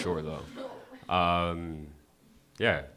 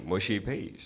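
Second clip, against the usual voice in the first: normal talking voice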